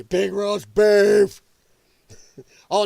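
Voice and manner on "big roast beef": loud, very drawn-out relish; slow, booming mock tease; taunting food call. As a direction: stretch the words out to taunt someone hungry. Taunting hunger strikers with food.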